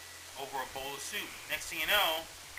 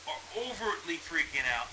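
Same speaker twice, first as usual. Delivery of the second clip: ordinary talk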